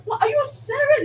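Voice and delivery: can't believe someone else won; disbelief. Retold or imitated. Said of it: "I'm like".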